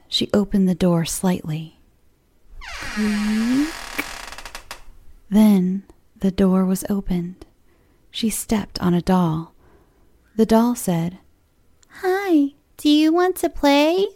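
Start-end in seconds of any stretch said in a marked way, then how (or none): 2.6-3.7 creaking door sound. Acted. Door opening in spooky story. 12.0-14.1 high, sweet doll voice; creepy doll, sweetly inviting; too calm creepy doll. Acted. Doll speaks in horror story.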